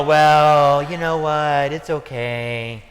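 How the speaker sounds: mock someone who can't be bothered; mock indifference. Mocks people who accept losing.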